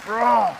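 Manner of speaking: short roaring burst of power; explosive mouth-made whoosh; imitated roar.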